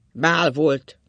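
loud, emphatic repeat; insistent repetition. A narrator's reading. Ákos repeats his jibe to his wife, loud and insistent. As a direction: hammer the words out loudly. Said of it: "ismételte Ákos".

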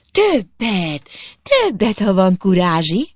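high, rising taunt; goading dare. A narrator's reading.

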